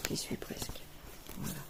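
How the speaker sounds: whispered to herself. Real, not acted. Showing her stitching progress, whispering to herself. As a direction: say it under the breath.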